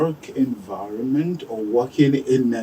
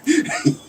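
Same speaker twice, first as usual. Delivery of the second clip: laugh. Real, not acted. Host laughing at the price.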